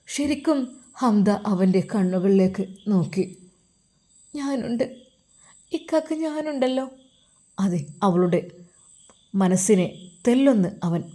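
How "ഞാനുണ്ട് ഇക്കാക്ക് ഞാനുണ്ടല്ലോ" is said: put-on soft girl's reassurance. Girl comforting Ajmal softly.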